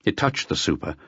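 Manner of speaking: ordinary delivery, nothing marked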